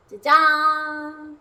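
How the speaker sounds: loud, drawn-out ta-da; triumphant reveal. Real, not acted. Cook presenting the finished langosh to viewers, triumphant. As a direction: announce it with a loud flourish.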